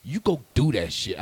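a hint of a pushy older mentor; older gentleman. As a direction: press it on him like a stern mentor. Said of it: "And he's like".

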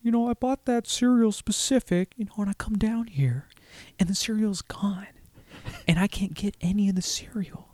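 high, hushed Owen Wilson half-whispering; imitating Owen Wilson upset about his cereal. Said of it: "Owen Wilson's upset 'cause someone ate all his cereal".

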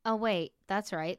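ordinary talk, nothing marked

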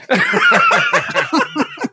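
loud, high burst of laughter; big laugh. Real, not acted. Host cracking up after retelling the joke, real laughter. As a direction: crack up hard.

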